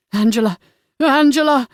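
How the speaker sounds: building loud cry of the name. Acted. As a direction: shout her name in release. Hero cries out at climax.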